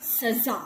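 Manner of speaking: सजा said with a z, like saza; teacher reading सज़ा with nukta; z sound. Shown as a demonstration. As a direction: say सजा with a buzzing z. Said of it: "इसका उच्चारण होगा".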